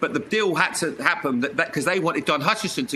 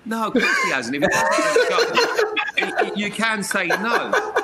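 dad's reply, laughing; 6 semitones higher, 3 dB louder, slower than usual. Retold or imitated. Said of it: "He went".

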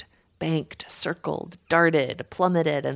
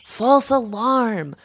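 higher, loud, drawn-out call; calling off alarm; 7 semitones higher, 7 dB louder, much slower than usual. A narrator's reading. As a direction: slowly call the all-clear to a flock. One swift stops the panicked flock.

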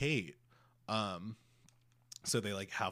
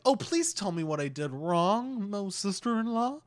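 higher, sugary pleading voice; mock sugary plea; 9 semitones higher, 6 dB louder than usual. Mocking Megan pleading with sister-in-law, sugary. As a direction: beg sweetly, overdoing the innocence. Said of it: "instead of being like".

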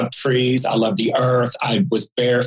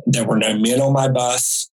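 ordinary talk

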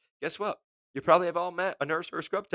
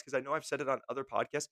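ordinary talk, nothing marked

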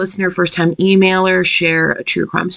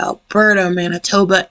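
usual speaking voice

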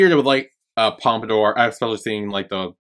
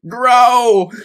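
mock shout; 13 semitones higher, 11 dB louder than usual. Cheering on regrown hair.

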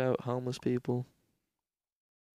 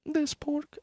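much higher, falsetto shopkeeper voice; high vendor voice. Retold or imitated. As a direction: squeak it out like a shopkeeper. Vendor voice again.

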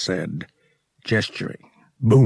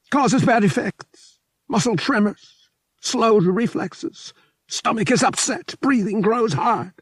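high, louder small alien voice, weary and unhappy; a sad small alien pilot, pitched up high; mournful little alien; 4 dB louder than usual. A narrator's reading. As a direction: fragile alien pilot lamenting, high and pressed. Alien describes spice poisoning.

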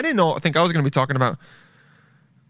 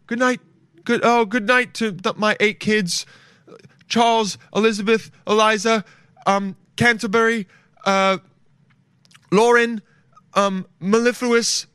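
put-on much higher, sing-song goodnight to the kids; smug old-timey father; 8 semitones higher, 3 dB louder than usual. Murderer bids goodnight to his eight kids, high sing-song mock voice. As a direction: lilt through the names like a proud fool.

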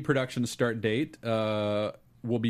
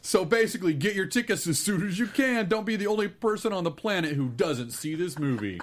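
big booming hype announcer, higher; monster truck ad; 7 semitones higher, 4 dB louder than usual. Acted. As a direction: sell it like a monster truck rally. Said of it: "announcing a monster truck rally".

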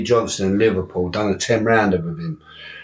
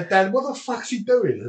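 retold alarmed cry; 11 semitones higher than usual. Panicked call to corner retold.

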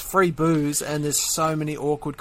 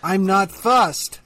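put-on slow, slightly higher girlfriend whine; American girlfriend; 4 semitones higher, much slower than usual. Girlfriend refusing to choose dinner, drawn out and fussy. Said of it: "Tom was being my American girlfriend".

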